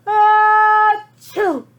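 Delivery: a very loud, high achoo; huge sneeze. Acted. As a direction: blast the sneeze out. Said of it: "and I sneezed".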